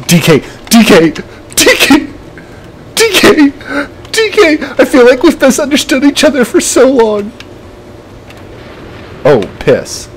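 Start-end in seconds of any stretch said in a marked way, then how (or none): 0.1-4.6 rising frantic pleading. Real, not acted. Gamer pleading with Donkey Kong, climbing higher and higher. 4.8-7.3 tense high plea. Real, not acted. High tense line mid-fight.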